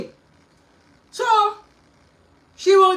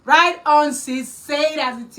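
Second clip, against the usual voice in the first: loud, high "Right on" then a slower "say it as it is"; cheering quote; 4 semitones higher, 9 dB louder, slower than usual. Retold or imitated. Quoting the doctor cheering her on, loud encouragement. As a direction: hype her up like a fan. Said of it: "doctor said".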